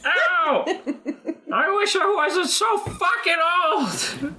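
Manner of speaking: put-on high, shouting old man; old man in pain. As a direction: wail like an aching old boss. Old boss hurting his balls.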